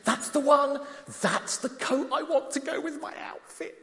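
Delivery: lightly voiced eager wife, pitched high; a hint of a pleading wife, high up. Wife voiced asking for coat.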